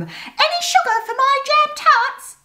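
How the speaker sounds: very high, squeaky mouse chatter; a chatty mouse telling a tale. A narrator's reading. Moby calling.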